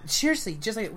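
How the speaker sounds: ordinary talk, nothing marked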